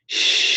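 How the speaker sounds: long, sustained "shh"; healing lung sound. Shown as a demonstration. Teacher showing the tone that heals the lungs, long shush. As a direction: let a steady shush slowly fade out. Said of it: "the universal tone".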